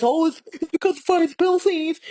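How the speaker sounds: mild mock-serious celebrity tone; mocking excuse. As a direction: parody her solemn excuse, lightly.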